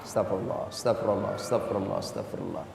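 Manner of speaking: very soft, low murmur, fading away; whispered istighfar. Shown as a demonstration. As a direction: murmur it quietly while sitting. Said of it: "sambil duduk istighfar". (istighfar lirih sambil duduk, makin pelan)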